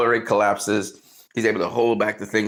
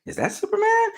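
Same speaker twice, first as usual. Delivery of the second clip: surprised question. Retold or imitated. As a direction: voice jumps high in disbelief. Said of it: "and you say".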